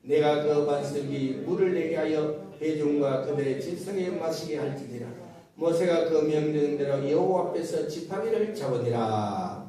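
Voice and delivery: low, firm recitation from the pulpit; scripture reading. Acted. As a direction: read the verse out solemnly and low. Reads scripture aloud.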